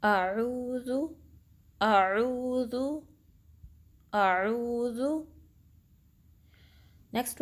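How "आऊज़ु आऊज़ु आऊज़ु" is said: slow, drawn-out recited आऊज़ु; Quranic recitation. Shown as a demonstration. Teacher recites for students to copy, slow and melodic. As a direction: chant आऊज़ु slowly in recitation style. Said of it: "आप इसकी recitation देख लें".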